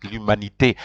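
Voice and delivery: l'humanité ending in "tay"; final E pronounced. Shown as a demonstration. French teacher contrasts the accented form, l'humanité ending "tay". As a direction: finish l'humanité on a sounded "ay". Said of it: "you'll never have".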